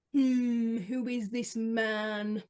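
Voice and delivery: low, drawn-out puzzled musing; hammed-up slow suspicion; mock suspicion. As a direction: slowly wonder who this stranger is. Acting out suspicion of a commenter.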